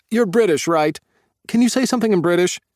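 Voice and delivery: high, loud, fast-rushing questions; eager. Acted. Tyler eagerly quizzing the British vet, high and fast. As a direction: pepper him with eager questions.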